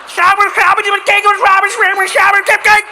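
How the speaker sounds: high, loud frantic yelling in mock Dutch; rapid-fire "ay, ay, ay" from a furious cyclist; hammed-up Dutch outrage; losing it. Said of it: "people lose their shit".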